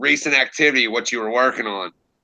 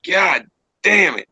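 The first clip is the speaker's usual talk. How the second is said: exasperated curse; 6 semitones higher than usual. Retold or imitated. Frustrated at own narration.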